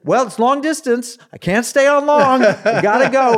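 high, loud imitation of a hurried caller; Paul Yonggi Cho. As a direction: call it down a long-distance line. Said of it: "a very Korean, broken English voice".

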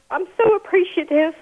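choked up, near tears; holding back tears while speaking; emotional, voice catching. Real, not acted. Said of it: "I'm emotional".

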